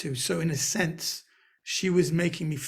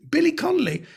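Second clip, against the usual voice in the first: surprised repeat; 11 semitones higher, 5 dB louder than usual. Retold or imitated. Retold shock at comparison.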